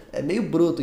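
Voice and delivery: normal talking voice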